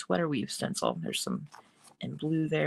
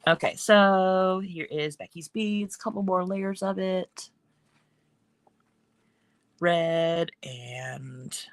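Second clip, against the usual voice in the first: deliberately slowed-down speech; talking slower; much slower than usual. Real, not acted. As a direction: draw the words out, taking it slowly. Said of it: "Talking slower".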